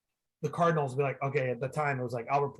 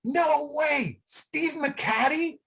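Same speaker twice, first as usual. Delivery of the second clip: high-pitched, disbelieving fan shout; excited yell; 11 semitones higher, much slower than usual. Retold or imitated. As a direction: call out in amazed recognition, voice way up. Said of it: "I yell out".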